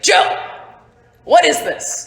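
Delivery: loud, high, laughing demand; exasperated cry. Acted. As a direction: call out to Joe in disbelief. [name] exclaims to Joe.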